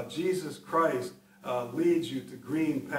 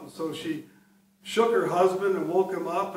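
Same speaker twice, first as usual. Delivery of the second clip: usual speaking voice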